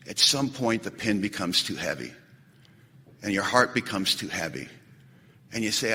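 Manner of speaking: heavy-hearted. Acted. Describing someone overwhelmed while writing inventory, hushed and heavy.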